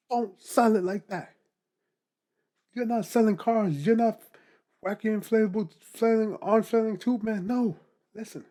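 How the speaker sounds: high, loud, slowed insistence; worked-up coaching rant; animated rant. Real, not acted. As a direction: hammer home the warning. Animated rant about salesy posts.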